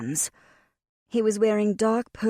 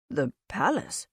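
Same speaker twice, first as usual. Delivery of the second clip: surprised. A narrator's reading. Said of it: "but simply surprised".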